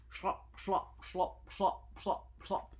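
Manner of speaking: rhythmic mechanical glop sounds; imitated machine glop. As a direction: chug it like a machine. Machine noise words.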